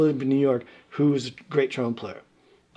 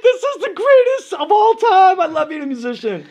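mock ecstatic young musician; thrilled; 8 dB louder than usual. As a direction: gush with delight. Thrilled at Studio 54.

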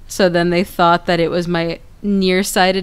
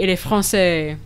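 normal talking voice

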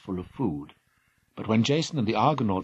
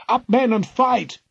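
much higher and louder rallying shout; battle cry; 13 semitones higher, 9 dB louder than usual. Acted. Jason rallying his crew against harpies, battle cry. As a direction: rouse the crew to fight. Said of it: "Jason drew his sword and cried".

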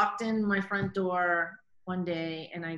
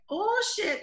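startled oh shit; 13 semitones higher, 4 dB louder than usual. Retold or imitated. Realizing it was Morgan.

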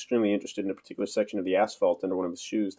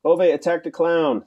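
put-on high, loud, slow toddler voice; three-year-old; 8 semitones higher, 7 dB louder, slower than usual. A narrator's reading. Three-year-old tells everyone about Ove, high childish announcement. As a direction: blurt it out like a small child. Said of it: "said the three-year-old".

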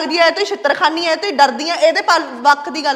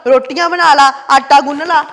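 louder mocking echo of her sister-in-law's commands; parroting orders back, a little louder and bossy; 3 semitones lower, 5 dB louder than usual. Mocking sister-in-law's orders, louder bossy echo. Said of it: "ਮੈਨੂੰ ਤੇ ਉਹ ਇੰਨਾ ਔਖਾ ਕੰਮ ਦੱਸਦਾ".

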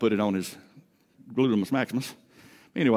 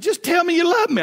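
high whiny plea; 7 dB louder than usual. Shown as a demonstration. Husband begging his wife in bed for reassurance, high whiny plea. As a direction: squeak it out like a needy kid begging. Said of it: "now she knows what's coming".

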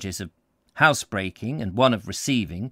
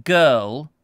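a hint of a fierce old woman, curt and louder; sudden correction; 3 semitones higher, 7 dB louder than usual. A narrator's reading. Missus Gurnet corrects the sergeant, curt and contemptuous. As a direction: snap the correction at him. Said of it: "said Missus Gurnet suddenly".

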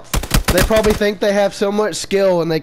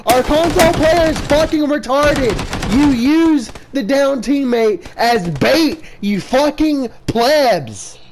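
raging gamer; 7 semitones higher than usual. Real, not acted. Raging after being finished.

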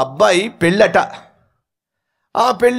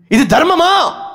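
angry shout; 10 semitones higher than usual. Acted. Wife protesting to husband.

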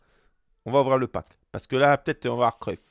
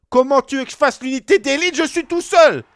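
high, loud indignant outburst; outraged protest; 6 semitones higher, 5 dB louder than usual. Retold or imitated. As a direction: shout it in disbelief at the boss. Protesting he must act alone. (protestation indignée, aiguë et forte)

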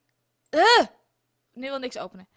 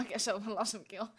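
normal talking voice

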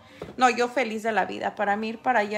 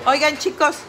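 calling out; 4 semitones higher, 8 dB louder than usual. Real, not acted. Calling to viewers over noise.

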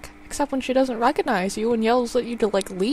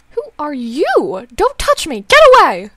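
scared mother shouting get away; frightened yell; 5 semitones higher, 9 dB louder than usual. Acted. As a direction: push the stranger away in fear. Mother recoils from son.

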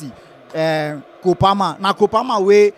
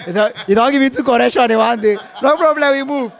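amused protest; 6 semitones higher, 4 dB louder than usual. Real, not acted.

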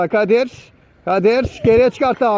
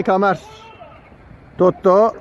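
usual speaking voice

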